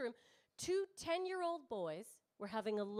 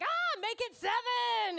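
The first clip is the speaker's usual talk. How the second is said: slow, high, loud and strange-voiced; hammed-up eager horror fan; eager wish; 10 semitones higher, 5 dB louder, slower than usual. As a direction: stretch it out, pitched up and loud. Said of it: "I'm always like".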